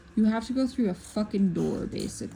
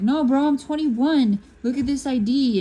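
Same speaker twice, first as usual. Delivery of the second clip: higher, louder, insistent pleading; insistent protest; 4 semitones higher, 5 dB louder than usual. Acted. Underage clubgoer to the bouncer about a fake ID, insistent. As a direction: act out arguing your way in.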